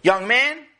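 loud, high, rising call; scolding farmer. Acted. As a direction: call the boy to account, pitched high. Farmer sternly addresses the boy.

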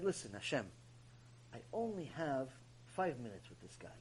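very soft, low appeal to God; low murmured plea; hushed private prayer. Retold or imitated. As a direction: confide quietly, almost under the breath.

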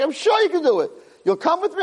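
high, animated back-and-forth; emphatic retold reassurance. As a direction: act out two friends egging each other on. Acted exchange of assurance.

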